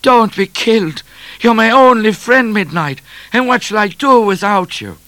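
high, loud hammed-up wail; distraught acted wailing; sobbing plea. A narrator's reading. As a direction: beg tearfully at full pitch. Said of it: "sobbed Trooper O'Grady".

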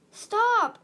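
sharp, high shout to stop; urgent cry. A narrator's reading. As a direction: cry out loud and high to halt someone. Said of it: "Starflight cried".

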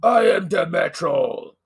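slow, loud wrestler boast. Pretend. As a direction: announce yourself like a wrestler. Wrestler name, slow louder.

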